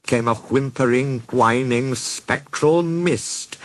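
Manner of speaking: ordinary delivery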